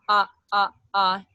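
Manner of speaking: short voiced "ah" sounds; cords-together "ah". Shown as a demonstration. Teacher showing cords coming together, voiced ahs. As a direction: demonstrate repeated voiced ahs. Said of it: "You bring your cords together".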